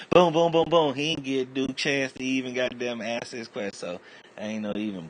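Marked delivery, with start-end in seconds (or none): 0.1-0.9 loud high boom boom sounds; loud imitated gunfire; gunshot noises. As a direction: mimic rapid gunfire.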